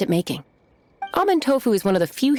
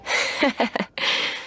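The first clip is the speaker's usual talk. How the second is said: soft chuckle; 3 semitones higher, 3 dB quieter than usual. Acted. Boss lady amused at chef.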